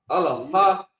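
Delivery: usual speaking voice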